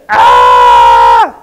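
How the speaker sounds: sudden loud cry of pain, very low; pained yelp. Retold or imitated. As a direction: yell as if the hammer hit your thumb. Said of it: "You probably went".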